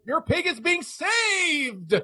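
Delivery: high, drawn-out cry; fervent proclamation. Acted. Believer answering the pig's owner, fervent proclaiming. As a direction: stretch it out slowly like a joyous revival shout.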